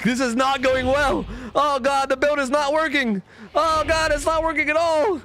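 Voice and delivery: high, wailing mock despair; mock panic. Gamer whose build is failing, high-pitched mock panic. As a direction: play up the panic, high and drawn out.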